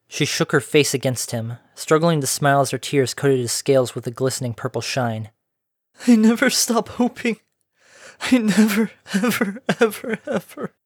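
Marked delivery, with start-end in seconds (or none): 6.1-10.7 put-on much higher, tearful young pony voice fading out. A narrator's reading. Pony hugging the dragon through tears, high and tearful. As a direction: sob through the words, trailing away. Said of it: "racked with sobs".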